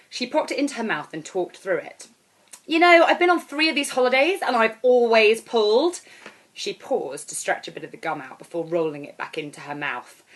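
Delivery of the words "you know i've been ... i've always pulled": put-on high, loud, brassy holiday girl; brash loud woman. Airport stranger hailing the narrator, high and loud. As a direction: belt it out like a brash party girl.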